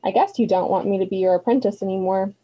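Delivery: ordinary delivery, nothing marked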